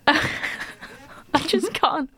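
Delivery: giggling through the words; can barely speak for laughing. Real, not acted. Laughing at accent.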